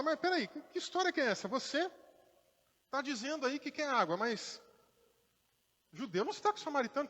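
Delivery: a woman objecting in disbelief; indignant retort. Acted.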